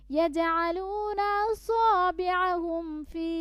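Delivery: high, slow melodic recitation; Quran recitation. Shown as a demonstration. Teacher recites the passage for students to follow. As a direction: chant slowly and lift the long notes.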